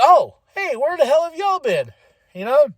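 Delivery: high-pitched, exclaimed surprise; surprised greeting. Retold or imitated. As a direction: greet long-lost folk with high-voiced surprise. Acts own surprised greeting.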